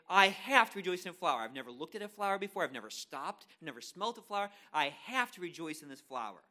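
loud emphatic protest, leaning on HAVE; put-upon objection, punched out; reluctant protest. Acted. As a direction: protest being made to do it. Acted protest at being commanded.

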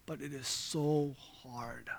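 low and soft, with heavy pauses, imitating a worn-down husband; retold weeping. Preacher retelling a caregiver husband's radio call, subdued sorrow. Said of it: "he was crying and weeping".

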